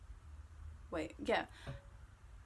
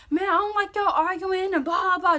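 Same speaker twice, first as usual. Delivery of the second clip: high, loud imitation of him ranting; loud mock rant, pitched up; going off; 9 semitones higher, 9 dB louder than usual. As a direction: mimic an irritated boyfriend going off. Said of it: "just going off".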